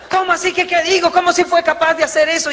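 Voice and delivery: very high, laughing indignation; outraged wife. Retold or imitated. Wife outraged at husband's reply, very high. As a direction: explode in disbelief.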